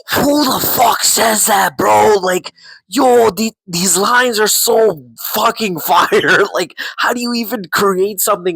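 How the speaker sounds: hyped disbelief. Real, not acted. Fan raving to viewers about Magneto's line, high and amazed. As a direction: gasp it out like the line blew your mind. Gushing over a great line.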